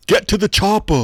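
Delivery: high, loud mock Austrian accent; Arnold Schwarzenegger. Game master showing whose line it is, Austrian-accent impression. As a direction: yell it like an Austrian action hero. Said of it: "which is Schwarzenegger".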